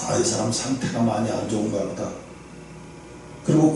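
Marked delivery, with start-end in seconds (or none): 0.1-2.1 low, soft murmur to oneself; private thought. Retold or imitated. Sizing up the troubled opposing lawyer to oneself, murmured. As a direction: quietly voice an inner realization.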